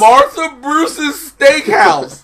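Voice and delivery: loud, high, drawn-out incredulity; laughing disbelief. Real, not acted. Co-host can't believe the steakhouse name, loud laughing disbelief. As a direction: stretch the name out, cracking up.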